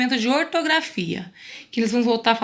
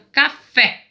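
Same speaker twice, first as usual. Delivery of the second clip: "café" slow, last syllable "fé" punched; teacher sounding out "café" with a strong "fé"; stress on FÉ; 3 semitones higher, 5 dB louder than usual. Shown as a demonstration. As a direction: split "café" as ca-FÉ, hitting the end hard. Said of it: "fé é mais forte".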